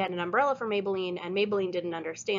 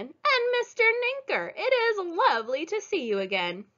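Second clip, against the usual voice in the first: put-on sweet old lady voice; 11 semitones higher than usual. Mrs Watson greets Leroy.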